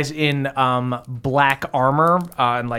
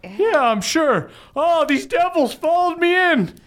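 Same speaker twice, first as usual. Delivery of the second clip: high-pitched character voice. Acted. Father answering the party after rushing in, high character voice. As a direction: play him high-pitched, stretching the opening. Game master voices Bev Senior arriving.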